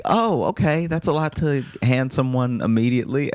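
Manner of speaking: high-pitched, mock-polite reaction to oversharing; taken-aback dater. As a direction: play someone politely thrown. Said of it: "And then you're like".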